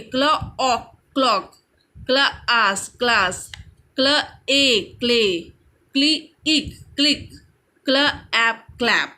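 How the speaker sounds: क्ल, a gap, the ending, then the whole word as in क्लॉक; teacher's measured word-building from क्ल; sounding out blends. Shown as a demonstration. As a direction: phonics drill, split क्लास into क्ल and आस, then join. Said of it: "C L हो गया क्ल".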